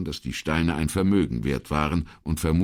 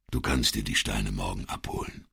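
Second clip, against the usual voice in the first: understated, matter-of-fact remark, lower and softer; lapidar, knapp; 6 semitones lower, 5 dB quieter than usual. A narrator's reading. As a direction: say it tersely, low and subdued. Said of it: "sagte nur lapidar". (lapidar, tief und zurückgenommen)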